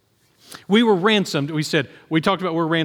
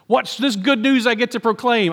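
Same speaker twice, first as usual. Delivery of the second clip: eager, higher-pitched question; bright eager prophet asking; excited Isaiah; 6 semitones higher than usual. Acted. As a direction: voice Isaiah thrilled to finally share good news. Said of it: "Isaiah's all excited".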